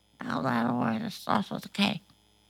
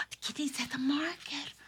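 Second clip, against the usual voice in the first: excited whisper; 4 semitones higher, 11 dB quieter, faster than usual. Acted.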